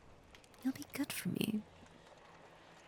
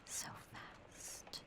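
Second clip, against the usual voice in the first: possessive whisper; 10 dB quieter than usual. Acted. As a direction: whisper it like a secret over their heartbeat. Listening to the captive's heartbeat.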